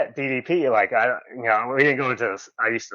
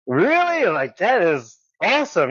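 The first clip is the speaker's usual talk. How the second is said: very high incredulous question, then delighted; retold thrilled surprise; 8 semitones higher, 3 dB louder than usual. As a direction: act out a kid amazed by an offer. Retelling reaction to being hired.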